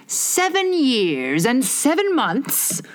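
slow, musing repeat; a hint of a pondering egg; thoughtfully. A narrator's reading. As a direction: weigh each word as you turn it over. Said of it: "Humpty Dumpty repeated thoughtfully".

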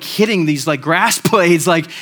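amused, a laugh in the voice. Real, not acted. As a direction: laugh as you describe it. Laughing at his own yard story.

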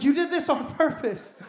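high, loud, incredulous laughing question; mock-shocked dad asking his son; shocked disbelief. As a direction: act out a father's stunned disbelief through a laugh. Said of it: "I was like".

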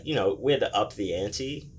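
ordinary talk

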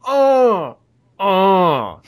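loud, high, drawn-out oh; two big swooning ohs, falling at the end; hammed-up delighted moan. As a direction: moan loudly in mock ecstasy. Overblown delight, then laughs.

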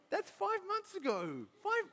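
high-pitched comic disbelief; incredulous exasperation. Retold or imitated. Dad exasperated at his child's old grief, incredulous. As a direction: squeal it in mock exasperation.

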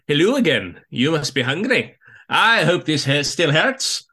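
performed Scottish lilt; amateur go at a Scottish character; attempted Scottish accent. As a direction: quote the line in a rough Scottish accent. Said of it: "I'll try to do it in a Scottish accent".

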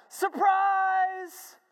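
high, drawn-out surprise shout; crowd yell. Retold or imitated. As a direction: yell it like a whole party crowd. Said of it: "they all yell".

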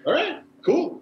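very high, easygoing reply; breezy mock acceptance. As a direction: brush off an unintelligible yell lightly. Joins in shrugging reply to yeller.

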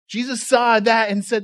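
ordinary talk, nothing marked

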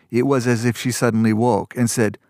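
ordinary delivery, nothing marked

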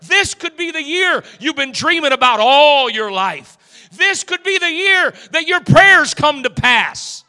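loud, slow, high preacher's cry; building revival preaching; preaching at full force. Acted. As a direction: lift the room with each line.

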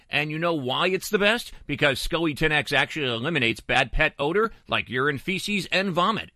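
upbeat radio ad read. Acted. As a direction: sell it like a radio host. Host reads sponsor ad.

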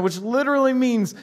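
ordinary talk

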